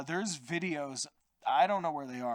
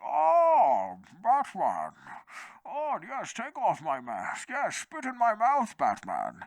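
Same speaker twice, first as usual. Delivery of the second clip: Bane voice; 5 semitones higher, 3 dB louder, slower than usual. Retold or imitated. Mocking Bane in a podcast rant.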